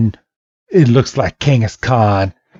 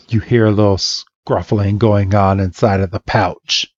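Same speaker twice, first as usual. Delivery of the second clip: ordinary delivery, nothing marked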